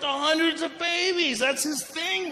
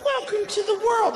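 high put-on new-mother voice; mimicked mother greeting her baby; imitating cooing wife; 8 semitones higher than usual. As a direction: coo high to a newborn. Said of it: "She's like".

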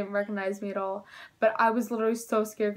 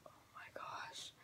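voiceless hushed gasp of fear; terrified whisper; 25 dB quieter than usual. Retold or imitated. Hiding kids whispering as the lady bangs on the door. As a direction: breathe it out without a sound.

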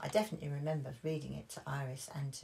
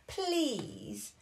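put-on high little-girl plea; high childish pleading voice; pleading Peppa; 11 semitones higher, 4 dB louder than usual. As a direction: beg sweetly for more play. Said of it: "asked Peppa".

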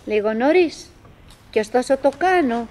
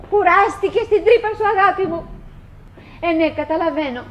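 higher, fond voice to her husband; tender call; 6 semitones higher, faster than usual. Acted. Winnie calling fondly to Willie in his hole. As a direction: coax him kindly.

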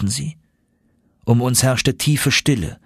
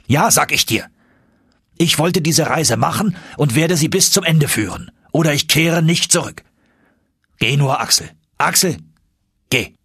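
higher, hard, threatening uncle; 6 semitones higher than usual. Acted. Uncle harshly ordering Axel to turn back, threatening. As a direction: push him away with a hard, threatening edge. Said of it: "Seine Stimme war wieder hart und drohend geworden".

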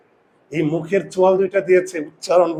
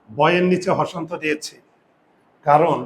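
ordinary talk, nothing marked

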